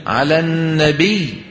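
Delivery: slow "على النبي" pressing the doubled ب; sheikh's correction demo; stressed doubled letter. Shown as a demonstration. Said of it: "شو بيقول لك؟".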